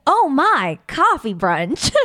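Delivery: very slow, laughing through "Oh My Coffee Brunch"; laughing ad slogan. Shown as a demonstration. Second pitch for the cafe ad, very slow and laughing. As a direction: stretch each word out while giggling. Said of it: "Or, like".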